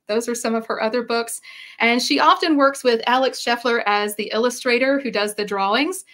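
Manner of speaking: usual speaking voice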